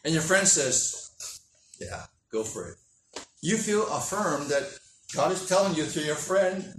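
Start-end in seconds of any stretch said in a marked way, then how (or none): 1.8-2.8 mumbled approval. Acted. Friend's careless approval.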